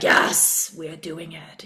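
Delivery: whispered, calm yes; soft soul whisper. Retold or imitated. Her soul quietly agreeing to the project, whispered. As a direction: breathe the yes like a secret.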